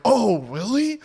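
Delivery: hammed-up fake surprise; playing dumb. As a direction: feign surprise to the cop. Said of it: "I played the dumbass card".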